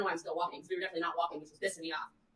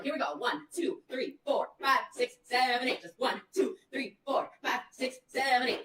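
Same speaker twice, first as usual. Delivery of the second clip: higher, rhythmic coach count; 4 semitones higher than usual. Retold or imitated. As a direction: count her steps like a coach. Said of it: "coach her through it".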